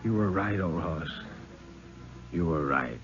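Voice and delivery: low, soft dying mumble, trailing away; mumbled. A narrator's reading. Freezing man to old timer, soft mumble. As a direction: barely get the words out, fading. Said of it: "and he mumbled".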